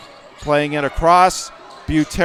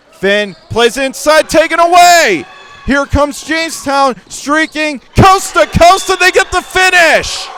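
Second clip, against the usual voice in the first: excited call; 10 semitones higher, 6 dB louder than usual. Real, not acted. Coast-to-coast score.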